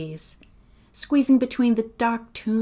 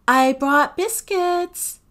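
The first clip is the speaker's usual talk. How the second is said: high, slow, loud sing-song; luring call; 9 semitones higher, 6 dB louder, slower than usual. A narrator's reading. Sophie lures Agatha back to the door, calling out. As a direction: dangle the bait, drawn out and pitched up.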